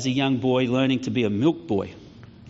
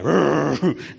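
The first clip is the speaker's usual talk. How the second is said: imitated dog growl; 3 semitones higher, 3 dB louder than usual. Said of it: "the big dogs would go".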